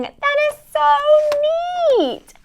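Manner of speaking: high, very stretched-out delight; thrilled exclamation. Acted. Host gets watercolor supplies like Juliet's, thrilled exclamation. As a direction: gush it, drawing the words out.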